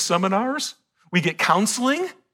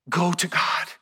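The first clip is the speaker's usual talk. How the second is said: whispered plea. Acted. Hezekiah whispering to Isaiah, begging. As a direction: whisper "go to God". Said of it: "he said".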